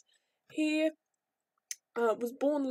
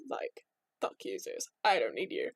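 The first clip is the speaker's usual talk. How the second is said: lower, hushed brush-off; spurned wife dismissing Zeus; dismissive whispered jab; 8 semitones lower, 4 dB quieter than usual. Retold or imitated. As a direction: mutter it defiantly. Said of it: "Hera's like".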